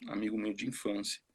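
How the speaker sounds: ordinary talk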